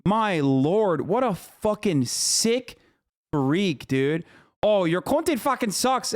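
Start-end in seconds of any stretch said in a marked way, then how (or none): 4.6-6.1 high sneering imitation of a hater; mocking whine. Streamer mocks the hater's comment in a high sneering voice. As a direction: parrot the insult back with contempt.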